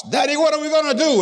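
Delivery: retold, high-pitched cry for Daddy; hollering daughter. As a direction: imitate an excited child hollering. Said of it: "she was hollering".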